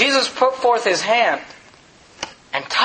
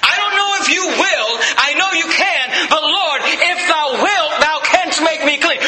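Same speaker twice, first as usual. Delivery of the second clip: crying out; 5 semitones higher than usual. Retold or imitated. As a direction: beg the Lord with all you have. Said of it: "you cried out to Jesus Christ".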